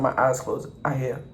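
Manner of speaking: ordinary delivery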